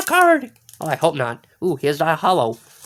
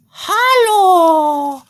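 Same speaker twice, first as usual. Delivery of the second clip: excited wail; 11 semitones higher, 5 dB louder than usual. Real, not acted. Cries out over holo card.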